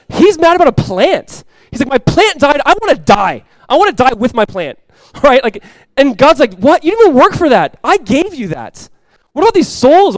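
high, loud preaching cry; passionate preaching. Acted. Preacher confessing to his church, high and loud. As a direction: pour out the confession at full force.

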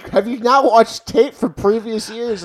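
high, loud exasperated shouting; ranting at the TV. Retold or imitated. Fan yelling at the coaches through the TV, loud and exasperated. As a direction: lecture the coaches at full volume. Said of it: "I'm like".

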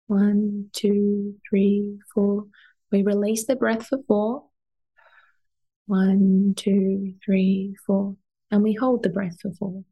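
slow guided breath counting; box breathing count. Acted. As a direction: count the breaths calmly with long pauses. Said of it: "Another one is box breathing".

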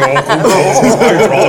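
slow, high laughing squeak; helium voice. Retold or imitated. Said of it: "Depois ele próprio estava a fazer".

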